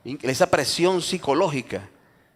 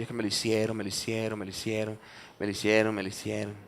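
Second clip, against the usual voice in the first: low, soft repetitive moan; mock bitter grumbling; 4 semitones lower, 9 dB quieter than usual. Bitter person nursing an old wrong, mock grumbling. Mimics someone stuck in bitterness.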